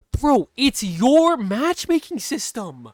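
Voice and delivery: real high, loud, exasperated outburst; outraged rant. Real, not acted. Streamer railing at the game company for blaming the player, outraged. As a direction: rail loudly at the unfairness, pitch way up. Ranting at the company over the ban.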